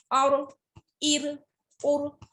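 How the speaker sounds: और with a thick, full र; heavy r. Shown as a demonstration. Teacher to students, bold heavy r. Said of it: "रॉ को bold पढ़ते हैं".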